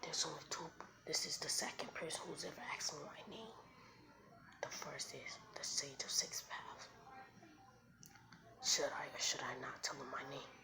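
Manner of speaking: soft, half-whispered musing; hushed private deliberation; inner thought. Acted. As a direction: murmur it low, as if thinking to yourself. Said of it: "But in his head, he says".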